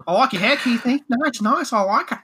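high, chatty Australian impression; Australian accent. Chopper admiring Keithy's hair, high Australian accent. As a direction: compliment him in a put-on Australian voice. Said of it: "Oh, Australian".

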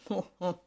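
low, short villain laugh; wolf's chuckle. A narrator's reading. Hungry wolf to himself, chuckling. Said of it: "se dit-il en riant".

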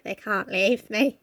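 slow, soft, sinister voice; a hint of a possessive villain; creepy whisper. Voicing Beth's creepy thought.

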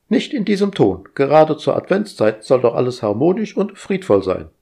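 high, bossy, busy voice; fussy household robot. Acted. Household AI fussing at Roger, high and bossy. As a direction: nag like an overeager house AI.

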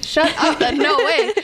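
high, no-way disbelief; amused disbelief. Retold or imitated. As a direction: gasp it in happy shock. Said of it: "And I was like".

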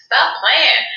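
high-pitched mock indignation; retold indignant objection. Sister splashed with holy water telling Tamar off, mock indignant.

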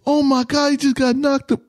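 high excited bystander yell; hyped bystander. Retold or imitated. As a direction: shout it like you're filming a fight. Voicing the filming bystander.

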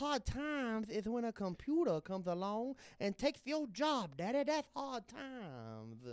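high, slow lisping wrestling promo drawl; Dusty Rhodes voice. Retold or imitated. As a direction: preach it slowly like a Southern wrestling star. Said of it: "You ever heard my Dusty Rhodes?".

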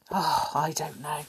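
low, whispery sighed aside; hushed aside. A narrator's reading. Narrator sighing over a stubborn picnicker, hushed aside. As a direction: sigh it out under your breath.

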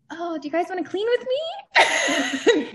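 mock-sweet invitation, pitched high; high, stretched-out question, rising at the end; coaxing mom. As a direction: coax the kids in a high mommy voice. Mother inviting children to clean.

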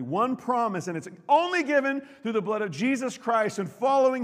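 loud, high impassioned preaching; preaching at full force. Real, not acted. Preacher pleading with the congregation, impassioned. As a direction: drive the promise home to the crowd.

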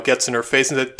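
ordinary talk, nothing marked

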